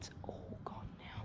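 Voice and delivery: whispering. Real, not acted. Hiding from light at night.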